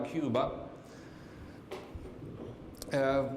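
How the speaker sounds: normal talking voice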